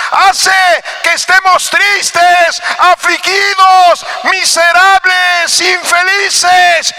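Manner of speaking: high, loud, slow pulpit shouting; preaching at full force. Acted. Pastor warns the congregation against sin, high slow fiery shouting. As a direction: hammer the warning home from the pulpit.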